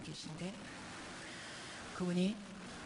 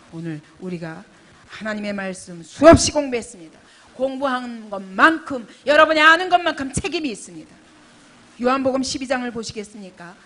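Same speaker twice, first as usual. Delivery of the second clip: full-force preaching; 2 semitones higher, 16 dB louder than usual. Acted. Pastor pressing the congregation, preaching at full force. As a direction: preach it out to the whole hall.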